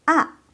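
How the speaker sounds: A falling sharply from high; fourth tone. Shown as a demonstration. Teacher reviewing tone four, A high falling. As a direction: drop A quickly from the top. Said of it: "keempat itu".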